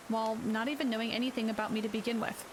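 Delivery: plain reading voice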